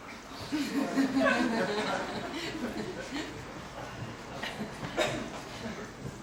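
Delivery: a long high burst of laughter. Real, not acted. Poet cracks up at her own line. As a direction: break into real laughter.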